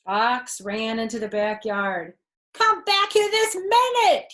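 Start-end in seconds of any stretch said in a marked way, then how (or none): 2.5-4.3 very high, loud command; cry out. A narrator's reading. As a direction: shout after the flying kids. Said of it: "he cried".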